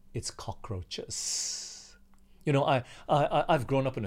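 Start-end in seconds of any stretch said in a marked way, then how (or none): 0.1-2.0 slow, breathy whisper of disgust; whispered, drawn-out disgust at cockroaches. Real, not acted. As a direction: drag it out in revulsion.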